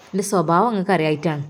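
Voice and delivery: ordinary delivery, nothing marked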